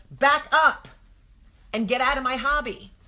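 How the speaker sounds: loud mock scolding; mock annoyed. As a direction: shoo him away, mock irritated. Annoyed at husband at shopping.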